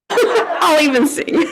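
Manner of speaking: high, slow words through a real laugh. Real, not acted. Song leader offering to sing along, laughing.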